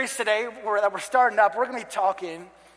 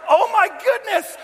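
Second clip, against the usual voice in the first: very high, loud exclamation; hammed-up horror, voice leaping way up; mock shocked yell; 14 semitones higher, 7 dB louder than usual. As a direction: squeal it loudly in playful panic. Retold shock at the fire.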